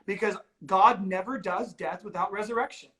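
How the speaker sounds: ordinary talk, nothing marked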